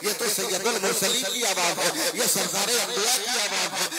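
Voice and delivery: ordinary talk, nothing marked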